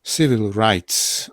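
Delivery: Civil rights slowly, rights ending in a clear s; slow demo. Shown as a demonstration. Teacher showing the voiceless s to students. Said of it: "bo to jest T i dlatego to jest S".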